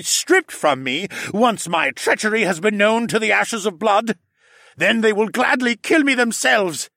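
loud, high villain voice; gloating treacherous councilman; sneering villain. A narrator's reading. Hess villain speech.

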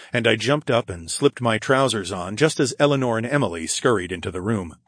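plain reading voice

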